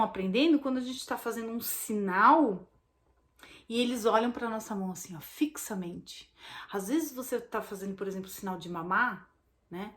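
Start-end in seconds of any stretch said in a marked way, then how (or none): none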